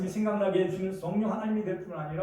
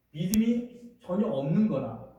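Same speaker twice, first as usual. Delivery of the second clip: normal talking voice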